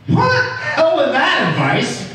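high-pitched indignant protest; incredulous mock objection; mock outrage. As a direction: imitate an audience member balking, voice leaping up. Acted objection from the audience.

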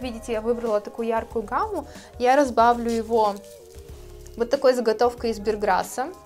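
ordinary talk

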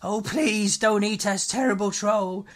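high, loud frightened pleading; pleading cry. A narrator's reading. Men begging the terrible troll to spare them, frightened pleading. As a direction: beg the troll for mercy. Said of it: "cried the men".

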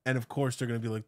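normal talking voice

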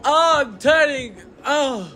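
high excited shrieks; shrieking jackpot winner. Real, not acted. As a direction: scream with joy at the win. Big gacha win, screaming.

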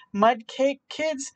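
usual speaking voice

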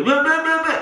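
high, loud tongue-flapping blah; floppy-tongue babble. Shown as a demonstration. Hypnotist showing a tongue falling out, babbled. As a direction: let the tongue flop through the noise. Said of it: "your tongue just gets in the way and flops".

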